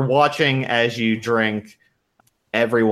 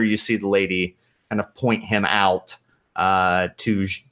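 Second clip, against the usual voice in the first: ordinary talk, nothing marked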